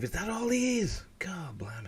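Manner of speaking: unvoiced, astonished aside; whispered surprise. Real, not acted. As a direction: hush it like a private shock. Surprised aside about actor's age.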